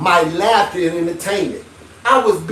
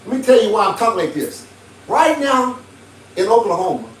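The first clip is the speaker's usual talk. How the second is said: ordinary talk, nothing marked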